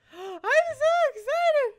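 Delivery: gasp, then a slow, high squeal; bursting with excitement. Real, not acted. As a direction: draw it out in a giddy, high-pitched cry.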